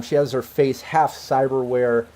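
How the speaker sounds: usual speaking voice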